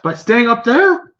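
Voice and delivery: high, loud, slow goblin sneer; mock their cowardice; sarcastic goblin. Goblin mocking the party that stayed on the wall, high sneer.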